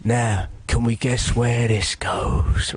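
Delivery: very slow, theatrical threat; menacing torturer. Retold or imitated. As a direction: draw out each word like a hammy torturer. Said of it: "he pulls out a knife".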